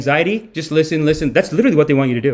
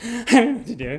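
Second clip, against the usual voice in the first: mock distressed girlfriend; 9 semitones higher, 3 dB quieter than usual. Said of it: "she's like".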